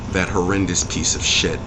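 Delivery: normal talking voice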